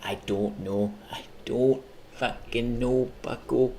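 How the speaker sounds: slow hint of a Midwest accent. Streamer answering himself in a slow Midwest accent. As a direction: stretch "don't" like a Midwesterner. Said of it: "the Midwest accent".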